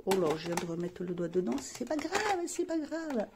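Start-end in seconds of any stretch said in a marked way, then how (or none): 1.6-3.3 high, singsong little reassurance; high-pitched "no big deal" singsong; playful self-reassurance. Real, not acted. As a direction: lilt it up high, lightly brushing off a mishap. Reassuring herself after smudging a product.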